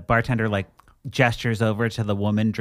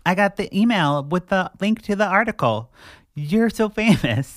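higher, gushing fan voice; gushing fan caller; 8 semitones higher than usual. Retold or imitated. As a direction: play an overexcited fan who saw the article.

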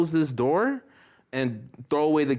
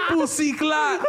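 high, laughing Jamaican patois; Jamaican accent; 11 semitones higher, much slower than usual. Pretend. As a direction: throw on a playful Jamaican accent. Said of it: "Do a Jamaican accent".

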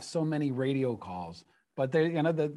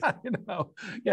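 laughing; 6 semitones higher than usual. Real, not acted. Laughing at Statler and Waldorf joke.

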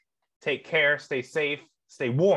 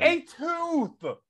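very high-pitched coo of adoration; swooning gush; 12 semitones higher than usual. Pretend. Fan cooing over a crush's broken tooth, swooning. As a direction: squeal it like a smitten fan.

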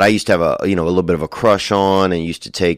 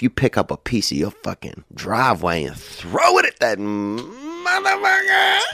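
high, drawn-out mock yell at the end; hammed-up shout at a rival kid; 4 semitones higher, slower than usual. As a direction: hurl the last word like the rock. Throwing driveway rock at someone.

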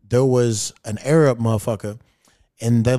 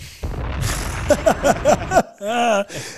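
a high burst of real laughter; 9 semitones higher than usual. Real, not acted. Podcaster laughing at his own crude joke, high laughter. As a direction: crack up at your own line.

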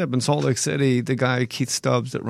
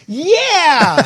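high, loud hammed-up kid cheer; imitated TV-kid son; 8 dB louder than usual. Dad imitating his son offered a kayak trip, hammed-up kid voice. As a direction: mimic a kid who watches too much children's TV. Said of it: "who talks like that?".

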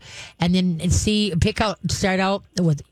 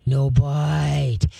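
slow, low, firm "no bite"; firm command; 3 semitones lower than usual. Shown as a demonstration. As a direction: drag it out sternly to the puppy. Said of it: "you wanna become a ah-er".

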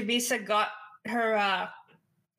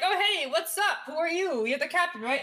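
bright mock greeting; 7 semitones higher than usual. Players greet the ship captain.

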